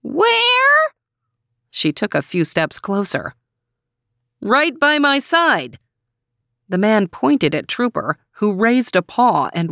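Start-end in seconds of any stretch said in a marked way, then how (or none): 0.0-0.8 very high screechy question, rising; hard-of-hearing old lady's screech; screechy old woman. A narrator's reading. Old woman who cannot hear the man, high and screechy. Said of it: "It was high and screechy". 4.4-5.8 slow, high-pitched raised voice; mild yell. A narrator's reading. Man answering hard-of-hearing neighbor, slow mild yell. Still shouting for deaf neighbor.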